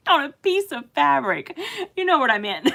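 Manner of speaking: real laughter breaking into the words; amused, giggly self-correction; laughing at own slip. Real, not acted.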